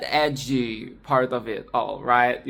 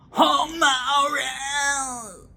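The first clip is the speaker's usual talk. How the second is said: wailing mock cry; 9 semitones higher, much slower than usual. Mocking dramatic exclamation.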